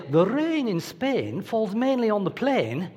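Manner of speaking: slow, crisp Received Pronunciation English accent, every vowel rounded; posh English accent. Shown as a demonstration. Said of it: "certain accents are worth more than others".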